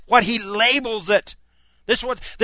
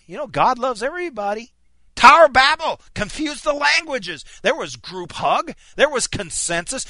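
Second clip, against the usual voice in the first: fiery preaching; 3 semitones higher, 3 dB louder than usual. Acted. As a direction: preach it hard to the congregation, pushing each line. Preacher exhorts congregation to pray.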